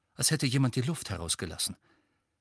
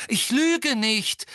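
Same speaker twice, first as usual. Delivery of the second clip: higher, louder, slow insistent cry; 13 semitones higher, 6 dB louder, slower than usual. A narrator's reading. Harry protesting to the court, crying out loud. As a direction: shout it over the murmuring court. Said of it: "rief Harry laut".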